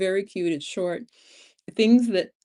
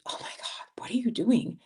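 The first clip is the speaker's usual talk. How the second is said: hushed then a sharper mock New York scold; a lightly voiced New Yorker, half under breath; 4 semitones higher, 6 dB quieter than usual. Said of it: "the New Yorker in me was like".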